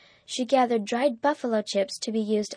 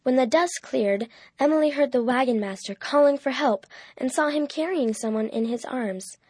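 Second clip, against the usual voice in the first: ordinary delivery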